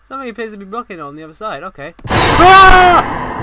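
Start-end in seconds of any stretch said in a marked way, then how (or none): 2.1-3.4 very loud, high scream; startled scream. Real, not acted. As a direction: scream in sudden fright. Jump scare in game.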